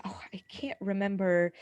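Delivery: quick whispered muttering; mumbled to herself. Real, not acted. Recalling her Japan tag match, whispered to herself. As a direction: think aloud under your breath.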